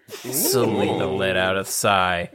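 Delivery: ordinary talk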